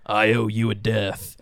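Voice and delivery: slow, menacing villain threat; slow theatrical villain menace. Retold or imitated. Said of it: "Quaritch looks at Neytiri and says".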